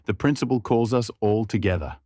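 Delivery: plain reading voice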